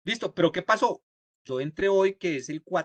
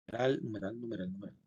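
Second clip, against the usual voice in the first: low, soft repeated mumble to self; muttering while typing; 5 semitones lower, 8 dB quieter than usual. Real, not acted. Teacher to himself while writing numeral signs, muttering. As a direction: count them off under your breath, lower.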